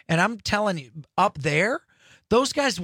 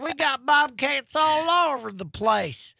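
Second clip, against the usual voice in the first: drawled southern invite, pitched up high; southern accent; 11 semitones higher than usual. Retold or imitated. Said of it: "I say that accent again".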